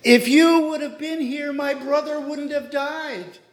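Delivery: quoting the grieving sisters' reproach to Jesus, guilt-tripping; guilt trip. Retold or imitated. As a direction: lay the blame on him, mildly mocking. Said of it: "they try and lay a guilt trip on him".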